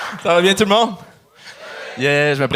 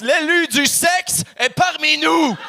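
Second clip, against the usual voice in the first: mock herald; 10 semitones higher, slower than usual. Mock grand announcement.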